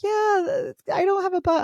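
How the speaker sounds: high, whiny imitation of a young girl; whiny stepdaughter. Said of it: "And she's like".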